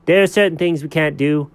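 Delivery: usual speaking voice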